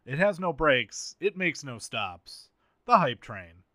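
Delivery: announcer sponsor read, loud and punchy. Pretend. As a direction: sell it like a radio ad.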